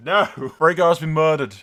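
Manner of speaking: ordinary talk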